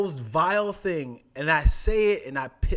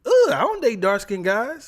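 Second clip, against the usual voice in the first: dismissive; 8 semitones higher, 9 dB louder than usual. Retold or imitated. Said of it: "I was insulted by".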